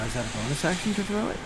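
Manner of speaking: usual speaking voice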